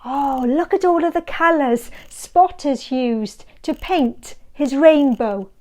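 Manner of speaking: usual speaking voice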